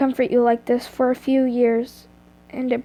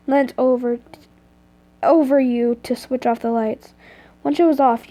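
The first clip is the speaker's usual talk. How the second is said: ordinary delivery, nothing marked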